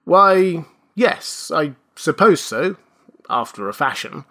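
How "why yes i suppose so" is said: irritated. A narrator's reading. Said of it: "He appeared somewhat irritated".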